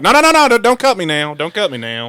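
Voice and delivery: high, loud mock plea, fading to normal; imitated frantic baby, starting very high; panicked baby. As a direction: play a baby begging in a panic, then settle. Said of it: "all I pictured was a baby being like".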